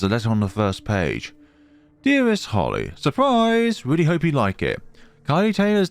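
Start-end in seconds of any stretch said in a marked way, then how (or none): none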